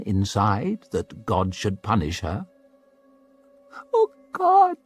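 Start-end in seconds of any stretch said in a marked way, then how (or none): 3.9-4.8 put-on a frightened girl whimpering to God. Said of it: "she whimpered".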